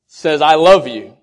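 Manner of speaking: loud, with weight on love; emphatic quote. Acted. Preacher declaring how God says love, loud. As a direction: declare it firmly. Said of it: "That's how He".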